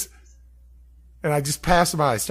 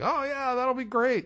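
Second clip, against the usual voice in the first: much higher, imitating keen guests; mock eager agreement; 12 semitones higher than usual. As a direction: pipe up high as the agreeable guests.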